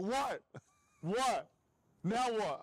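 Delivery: loud high shocked yelling. Retold or imitated. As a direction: yell it in disbelief. Friend's shocked reaction retold.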